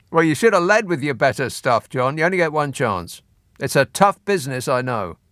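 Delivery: usual speaking voice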